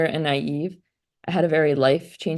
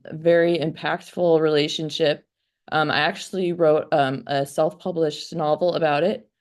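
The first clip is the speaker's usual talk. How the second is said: ordinary delivery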